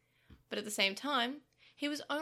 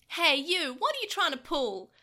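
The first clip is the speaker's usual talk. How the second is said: loud, higher angry challenge; angry demand; 5 semitones higher, 7 dB louder than usual. Acted. Taichi rounding on Pico Devimon, angry and loud. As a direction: call him out hard. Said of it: "Taichi demanded".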